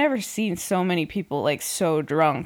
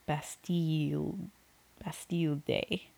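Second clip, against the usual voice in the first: French accent; 2 semitones lower, 9 dB quieter, slower than usual. Shown as a demonstration. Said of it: "put on, like, a French accent".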